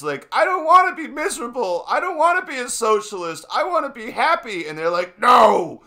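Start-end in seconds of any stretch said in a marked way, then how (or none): 0.3-4.7 put-on high whiny child voice, pleading; imitating a kid begging his parents; mock kid. Kid whining high at a screaming parent. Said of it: "adults screaming at their kids". 5.2-5.8 louder and higher, a hammed-up scolding shout; mock yelling parent. Parent yelling at a five-year-old, mock shouting. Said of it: "adults screaming at their kids".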